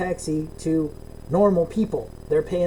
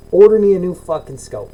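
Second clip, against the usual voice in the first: loud, forceful retold order; re-enacted fed-up demand; 2 semitones higher, 9 dB louder than usual. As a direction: replay laying down the law to customer service. Retold demand to customer service.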